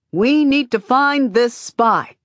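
loud, high, slow shout from afar. A narrator's reading. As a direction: call it out across the crowd. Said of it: "shouts someone".

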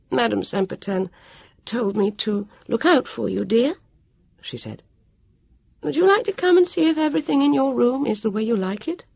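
a kind lady welcoming a guest; Petula, friendly. A narrator's reading.

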